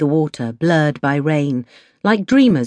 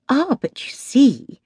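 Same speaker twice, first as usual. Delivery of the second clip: slow, mock-grave, holding back a laugh; playing serious while suppressing a giggle; mock seriousness; 7 semitones higher, slower than usual. A narrator's reading. Kitty teasing her friend in front of the sergeant, mock-serious and slow. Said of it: "pretending seriousness, trying not to laugh".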